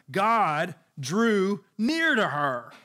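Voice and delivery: slow, drawn-out, leaning on near; slow emphasis. Acted. Preacher lingering on nearness for the congregation. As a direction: stretch each word out.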